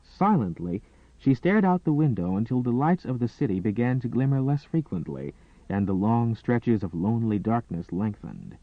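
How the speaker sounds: ordinary delivery, nothing marked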